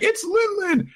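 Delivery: very high cry of alarm; girl runs to mother, very high alarm. Retold or imitated. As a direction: yelp it way up. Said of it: "she's like".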